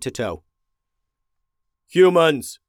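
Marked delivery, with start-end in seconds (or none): 1.9-2.6 loud barked alarm call; robot shout. A narrator's reading. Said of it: "a robot voice shouted".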